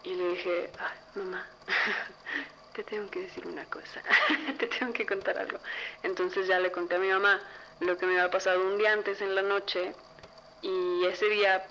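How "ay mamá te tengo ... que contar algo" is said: soft voice broken by little laughs; laughing confession. Retold or imitated. Daughter about to tell mom her secret, softly laughing. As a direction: giggle softly through a reluctant admission.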